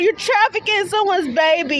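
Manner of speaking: usual speaking voice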